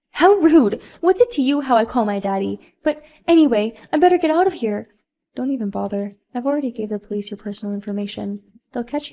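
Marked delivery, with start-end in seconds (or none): 0.1-4.9 high, loud indignation; offended spoiled mom. Acted. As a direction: huff and try to bolt. Sophia offended then wants to flee.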